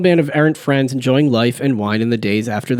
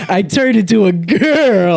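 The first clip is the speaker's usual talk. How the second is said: slow, higher mock announcement; silly kid reveal; 7 semitones higher, 4 dB louder, slower than usual. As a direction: draw it out like a proud kid. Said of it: "and he'd go".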